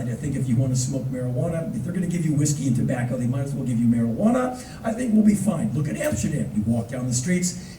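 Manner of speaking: usual speaking voice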